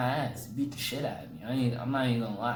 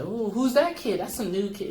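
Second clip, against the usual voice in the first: high, loud startled whine; alarmed kid; 10 semitones higher, 5 dB louder than usual. Retold or imitated. Boy sees a new kid he must fight, high startled whine. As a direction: squeal it out as a scared kid, pitched up. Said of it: "I was like".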